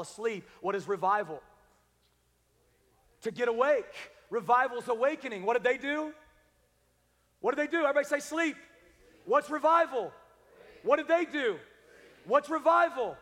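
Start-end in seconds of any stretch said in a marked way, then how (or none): 3.2-13.2 high, loud questions thrown to the crowd; rally the church to answer back; call-and-response preaching. Acted. Pastor quizzing the congregation on revival, loud call and response.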